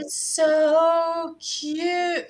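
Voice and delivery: high, slow gushing oh so cute; gushing over cuteness. Retold or imitated. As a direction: coo over it like a child would. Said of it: "she goes".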